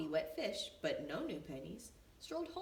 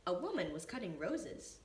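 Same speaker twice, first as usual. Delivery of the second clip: ordinary delivery, nothing marked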